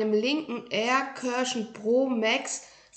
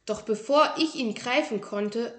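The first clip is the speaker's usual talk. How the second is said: plain reading voice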